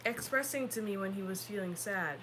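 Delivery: ordinary delivery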